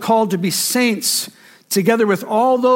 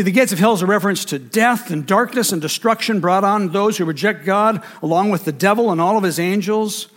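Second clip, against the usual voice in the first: ordinary talk, nothing marked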